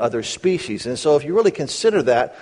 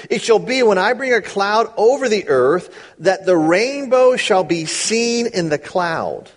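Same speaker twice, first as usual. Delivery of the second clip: slow, raised solemn reading; slow scripture reading; 5 semitones higher than usual. Acted. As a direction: lay each word down slowly and solemnly. Reads scripture aloud.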